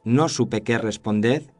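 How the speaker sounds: plain reading voice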